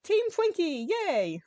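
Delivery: high, loud little cheer. A narrator's reading. Sparks cheering from the jet turret, excited. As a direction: cheer it like a happy kid. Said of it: "Sparks cheered".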